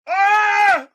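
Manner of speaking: very high, loud scream of pain. Acted. Thug screaming as his bone is crunched, piercing.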